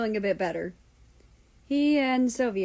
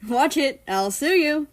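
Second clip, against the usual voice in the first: loud, higher mock threat; joking threat; 6 semitones higher, 6 dB louder than usual. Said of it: "U S S R joked".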